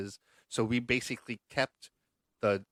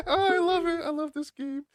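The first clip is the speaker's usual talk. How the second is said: higher, delighted gush; delighted squeal. Real, not acted. Host thrilled by the trivia answer, squealing delight. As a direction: gush over the game with a squeal.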